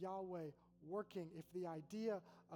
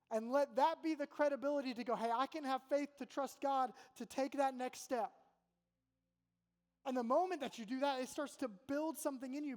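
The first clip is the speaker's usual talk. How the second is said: loud, raised, emphatic sermon voice; preaching at full force; 6 semitones higher, 9 dB louder than usual. Acted. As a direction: drive it home to the whole room.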